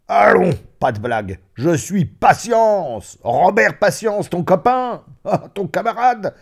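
high, loud, hearty greeting; booming reunion with a long-lost pal; boisterous old friend. Acted. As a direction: exclaim jovially, with a burst of laughter. Old friend boisterously introduces himself.

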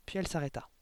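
usual speaking voice

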